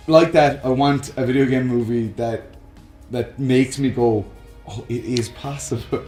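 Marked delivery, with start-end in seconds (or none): none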